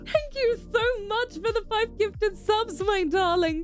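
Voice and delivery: high, drawn-out delighted thanks; gushing thanks. Real, not acted. Streamer thanking a viewer for five gifted subs, high gushing delight. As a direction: gush it slowly in a high, grateful voice.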